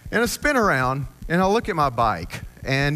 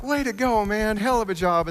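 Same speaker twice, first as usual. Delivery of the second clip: cheering racers; 10 semitones higher than usual. Retold or imitated.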